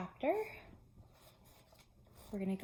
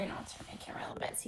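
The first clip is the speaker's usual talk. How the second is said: whisper; 9 dB quieter, much faster than usual. Real, not acted. Aside to viewers while reading.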